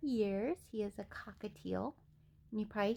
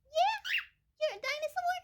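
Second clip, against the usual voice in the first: very high, louder coo; 4 dB louder than usual. Real, not acted. Owner squeals high baby talk at her cockatiel.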